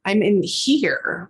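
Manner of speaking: a ghostly whisper saying it's here; whispered spirit. Retold or imitated. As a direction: breathe it like a ghost in the room.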